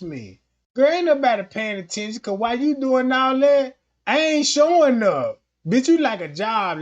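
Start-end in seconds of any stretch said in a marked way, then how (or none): none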